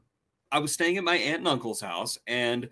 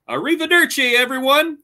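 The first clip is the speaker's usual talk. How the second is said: higher, louder cheerful goodbye; mock uncle farewell; 10 semitones higher, 7 dB louder than usual. Uncle leaving for work, mock cheery farewell. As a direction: sing out a breezy goodbye. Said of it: "And he says".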